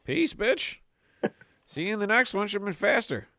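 high, smug send-off to a dying mouse; smug mock taunt; cocky farewell. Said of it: "I was like".

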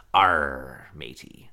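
low gruff pirate growl. Pretend. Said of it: "'cause it sounds like a pirate thing".

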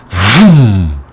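a big, loud vroom of a car starting; imitated car roaring away; loud imitated engine roar. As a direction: mimic an engine revving off, loud.

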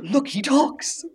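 high, slow mock marvel; mock amazement. Onlooker at a science project, high mock amazement. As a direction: marvel as if at a successful experiment.

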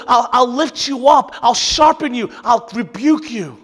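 thundering pulpit climax, let me tell you; preaching at full force. Acted. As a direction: build the sermon to a shouted peak. Sermon climax, building to shout.

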